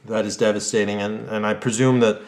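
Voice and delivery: news anchor read. Acted. Anchor reports back to viewers after the interview, somber and measured. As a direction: sign off the story like a solemn anchor.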